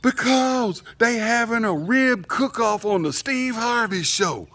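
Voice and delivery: slow, high, loud imitation of wife; wife hollering. Wife shouting TV news to her husband, mock holler. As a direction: holler it across the yard, word by word. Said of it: "my wife hollers out the back door".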